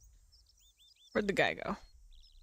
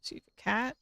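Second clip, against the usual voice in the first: usual speaking voice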